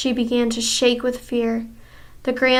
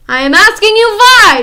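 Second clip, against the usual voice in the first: much higher, very loud shriek; screaming; 10 semitones higher, 13 dB louder than usual. Acted. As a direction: scream the why. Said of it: "she screamed".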